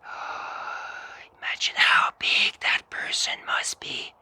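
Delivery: put-on hushed child's whisper of wonder; awed whisper. Child imagining the giant helper, awed whisper. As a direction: whisper it wide-eyed, full of awe. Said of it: "And it would say".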